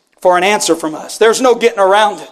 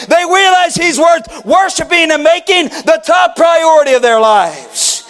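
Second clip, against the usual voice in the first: fervent preacher, raised and driving; preaching at full force; 5 semitones higher than usual. Acted. Pastor pressing the church with a fervent call. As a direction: press the question on the congregation.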